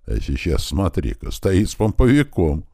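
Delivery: usual speaking voice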